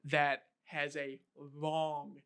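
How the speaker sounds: the word long stretched out very slowly; drawn-out long. Shown as a demonstration. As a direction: draw out long like an endless stairwell. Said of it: "The way you said long".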